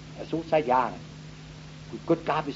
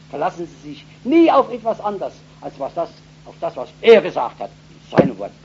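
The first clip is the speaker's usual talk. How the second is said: impassioned preaching; 3 semitones higher, 9 dB louder than usual. Acted. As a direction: preach with trembling passion. Preaching at full force. (leidenschaftliche Predigt, laut und hoch)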